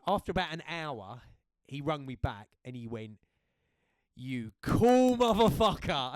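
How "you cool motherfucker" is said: loud, slow, delighted shout of praise; excited praise. Retold or imitated. Best friend on the phone after the fight, loud and thrilled. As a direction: hype up your mate like he's a hero.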